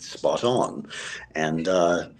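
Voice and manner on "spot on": put-on low-pitched British accent. Actor assuring a caller of his accent, British accent. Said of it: "how's your British accent".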